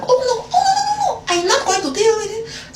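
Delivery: hammed-up shrieking no, no, no; mock shrill refusal. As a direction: squeal it like a dramatic diva. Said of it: "I was like".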